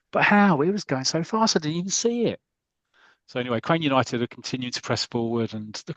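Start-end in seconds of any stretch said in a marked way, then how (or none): 0.1-2.4 amazed disbelief. Retold or imitated. Stunned striker after the save, high and amazed. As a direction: gasp it out in wonder. Comic goalkeeper shot voiced.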